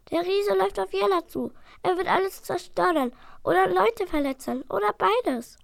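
high, hurried warning; urgent little dragon. Acted. Dragon warning his brother, high and urgent. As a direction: warn of the danger.

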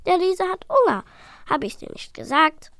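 high, loud told-you-so; gloating. Acted. Proud dragon to his brother, gloating. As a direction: brag to your brother.

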